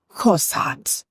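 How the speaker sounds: croissant again in a light French accent, falling at the end; a second careful try at a French accent. Shown as a demonstration. Retrying the word for viewers, light French accent. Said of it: "I don't know how to use my French accent".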